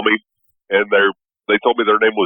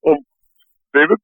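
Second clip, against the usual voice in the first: put-on tech support Indian accent, higher; 10 semitones higher than usual. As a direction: fake Indian call center voice. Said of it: "that's the Russian accent".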